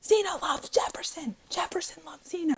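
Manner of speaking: high-pitched strained squeal, not full volume; squeaky half-whispered mock yell; mock scream. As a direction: imitate a friend screaming it out, held back. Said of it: "she just screams".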